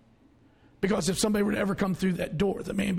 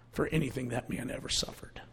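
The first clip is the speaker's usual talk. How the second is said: whisper; 7 semitones lower, 8 dB quieter than usual. Real, not acted. Solemn close of point.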